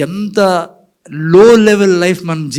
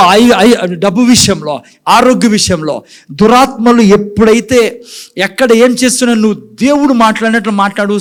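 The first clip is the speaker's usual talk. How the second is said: louder, rising preaching run; building sermon climax; 2 semitones higher, 4 dB louder than usual. Acted. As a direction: build the call to a peak. (క్రమంగా పెరుగుతున్న ఉత్సాహంతో బోధన)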